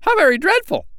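very high, shocked little bird squeal; aghast tiny sandpiper; crying out. A narrator's reading. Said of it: "cried both the little sandpipers".

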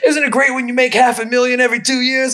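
high, put-on bragging voice; smug rich comic. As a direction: brag like a comic showing off his money. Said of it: "Nobody gets on stage and is like".

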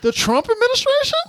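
high sarcastic disbelief; incredulous. Real, not acted. Friends reading [name] post, high sarcastic disbelief. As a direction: sneer the obvious answer up high.

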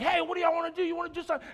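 high-pitched, worked-up dare; freaking-out challenge. Retold or imitated. Freaking out at approaching guys, high worked-up challenge. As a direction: freak out and challenge them to fight. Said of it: "I start freaking out at them".

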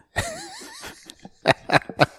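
a big real laugh that jumps high; hearty laugh. Real, not acted. Firefighter laughing at his colleague's old-guy jab, big laugh.

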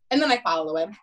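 normal talking voice

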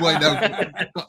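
usual speaking voice

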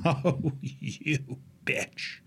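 hushed, acted venom fading to whisper; resentful mutter. Cramped passenger eyeing the sleeping seatmate, resentful hushed mutter. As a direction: curse her under your breath.

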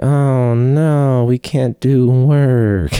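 high, drawn-out singsong fake lament; sarcastic high-pitched "oh no"; mock dismay. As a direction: feign being heartbroken, stretching the words. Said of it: "it was like".

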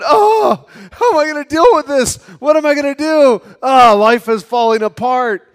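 high, loud, fast panicky wail; frantic hand-wringing lament; panicked worrying. Pretend. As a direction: cry out like someone whose life is collapsing. Preacher acts out a panicked believer.

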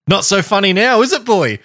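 high-pitched mock gloat; taunting. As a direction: rub it in, teasing. Host gloats after grim details.

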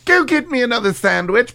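high posh command; imperious British order. Acted. Q orders his assistant.

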